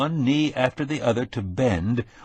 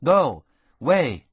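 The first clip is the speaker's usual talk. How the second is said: sharp loud one-word calls to the dogs; calling out mush commands; sled commands; 6 semitones higher, 4 dB louder, much slower than usual. A narrator's reading. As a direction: snap commands at the team. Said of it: "with one-syllable commands".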